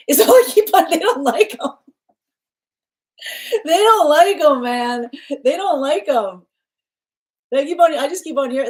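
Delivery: high, loud, laughing outburst; can't help cracking up; helpless amusement bursting through the words. Real, not acted. Cracking up at the reader's message.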